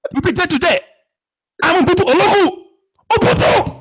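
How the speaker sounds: very high mock African war cry; African accent. Co-host threatening callers, very high African accent. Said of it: "I will use my African voice".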